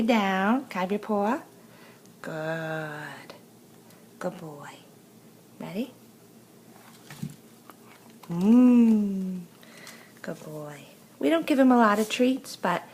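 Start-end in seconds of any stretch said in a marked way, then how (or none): 2.2-10.8 praising a dog. Real, not acted.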